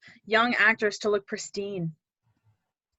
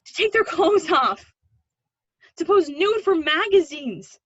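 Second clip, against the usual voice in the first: upset; 6 semitones higher, 6 dB louder than usual. Acted. Revealing a shocking truth.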